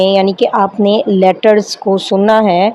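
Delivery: ordinary delivery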